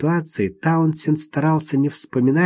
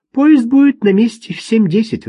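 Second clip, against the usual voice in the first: higher, louder female voice; woman's voice; 9 semitones higher, 6 dB louder than usual. Acted. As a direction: voice the woman brightly. Woman answers.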